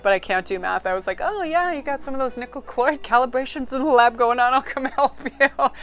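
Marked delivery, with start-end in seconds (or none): 1.2-5.7 high, hammed-up know-it-all lab talk; mock smart voice. Pretending to chat with physicists, a mock smart voice. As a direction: playfully fake sounding clever about science, breaking into a laugh. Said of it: "try to sound smart".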